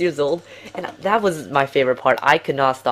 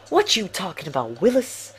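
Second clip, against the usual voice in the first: a light, loosely voiced movie-character impression that jumps much higher on Willis; quoting the Chinese guy; 4 semitones higher than usual. Telling viewers about the funny Chinese guy in The Hangover, imitating his line. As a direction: quote the funny line with a squeaky high finish. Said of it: "That Chinese guy, he is hilarious".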